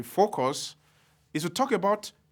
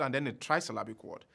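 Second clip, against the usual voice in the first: ordinary talk, nothing marked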